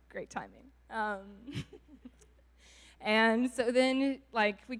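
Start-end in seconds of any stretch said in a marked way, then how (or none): none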